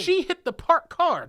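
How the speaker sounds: high, loud, drawn-out complaint; acted outraged insistence; indignant protest. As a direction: stretch each word in exasperation. Acted argument with the cop.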